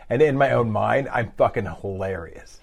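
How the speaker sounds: normal talking voice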